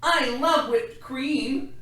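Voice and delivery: put-on high, slow, loud delighted child; little sister voice. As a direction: gush like a happy little girl. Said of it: "said his little sister".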